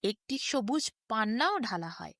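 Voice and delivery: ordinary delivery, nothing marked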